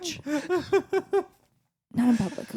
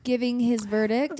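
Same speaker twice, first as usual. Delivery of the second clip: ordinary delivery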